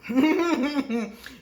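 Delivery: a loud burst of laughter. Real, not acted. Admitting to viewers the fight got ugly, laughing.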